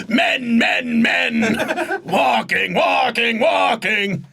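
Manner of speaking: shouted, high announcer chant; monster truck ad. Pretend. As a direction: bellow it like a stadium promo. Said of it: "like, a monster truck advert for it".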